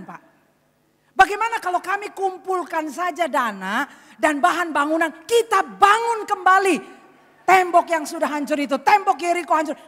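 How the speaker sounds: emphatic, high, booming proposal; forceful offer. Acted. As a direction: pitch a rebuilding plan with full conviction. Father makes a bold earnest offer.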